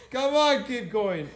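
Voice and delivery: loud, high encouragement; coach cheering. Real, not acted. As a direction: push her on hard.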